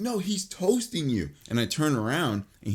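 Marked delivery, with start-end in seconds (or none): 0.0-1.3 high, drawn-out imitated yell; mock yell. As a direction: call it out slowly in a high mimicking voice. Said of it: "baby yells at me".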